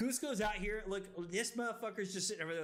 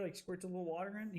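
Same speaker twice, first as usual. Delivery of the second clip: usual speaking voice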